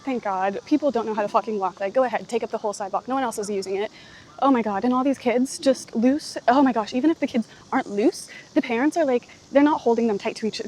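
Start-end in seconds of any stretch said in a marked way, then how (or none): none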